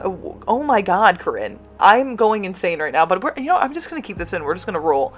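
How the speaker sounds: normal talking voice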